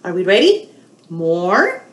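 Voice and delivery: ordinary delivery, nothing marked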